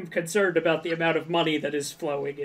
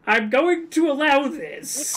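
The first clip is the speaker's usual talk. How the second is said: pompous ruler; 8 semitones higher than usual. Acted. Mock grave ruling in board game.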